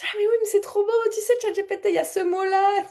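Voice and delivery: excited. Retold or imitated. Speaker retelling her excitement at ChatGPT finding the song, high and thrilled. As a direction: squeal with delight at finding the word. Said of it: "Je, je dis".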